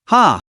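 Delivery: short sinister laugh; a quick scornful ha; gloating laugh. Acted.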